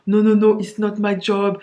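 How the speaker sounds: loud flat-French English no; blunt refusal. Retold or imitated. At work to English colleagues, a loud blunt refusal. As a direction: bark it out, no accent effort. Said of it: "avec zéro accent".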